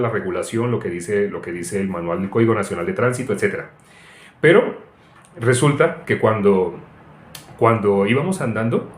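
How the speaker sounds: normal talking voice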